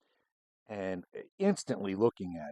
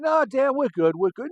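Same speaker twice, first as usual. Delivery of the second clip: much higher and louder shouted no thanks; loud refusal; 10 dB louder than usual. Retold or imitated. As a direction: wave him off with a loud raised voice. Cops refusing cigars, shouted.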